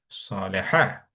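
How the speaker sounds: "সালেহা" with an open "ha" ending, no t. Shown as a demonstration. Teacher shows the feminine form, "সালেহা" ending in open "ha". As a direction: finish "সালেহা" on a clear "ha". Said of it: "একটা আ দিয়ে উচ্চারণটা হচ্ছে".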